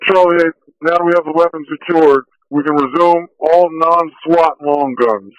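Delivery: ordinary talk, nothing marked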